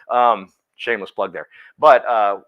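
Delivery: ordinary delivery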